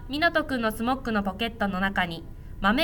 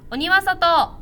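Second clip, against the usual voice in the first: higher, louder bean-throwing shout; 6 semitones higher, 5 dB louder, much slower than usual. A narrator's reading. Boy shouts at the red ogre, loud shout. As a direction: throw the beans and yell it out. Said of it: "と大きな声で".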